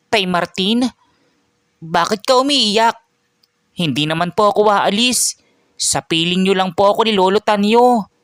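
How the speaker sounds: put-on high child's voice, gently reassuring; loving little boy. Child comforting crying Tay Martin, innocent reassurance.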